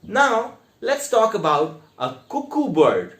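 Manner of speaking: ordinary talk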